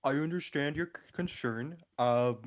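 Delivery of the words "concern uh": ordinary delivery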